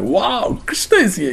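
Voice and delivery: lightly acted delighted surprise; breaking into a quiet, happy wow; happy amazement. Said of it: "He was so happy to see Krishna".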